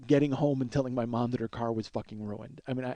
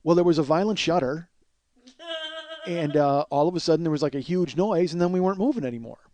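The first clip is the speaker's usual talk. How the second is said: louder, higher, eager mock alibi; seizing on an excuse; 4 semitones higher, 4 dB louder than usual. Boy grabs the mechanic's explanation to fool Dan, louder and over-eager. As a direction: pounce on the borrowed explanation and build it up. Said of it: "I think I've seized on something".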